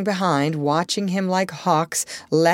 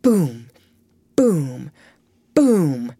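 low, spaced, forceful booms; deep echoing boom, evenly spaced; booming knocks; 3 semitones lower, 4 dB louder, much slower than usual. A narrator's reading. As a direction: imitate three hollow knocks with falling pitch. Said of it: "back came three answering knocks".

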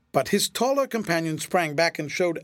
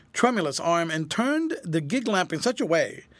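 ordinary delivery, nothing marked

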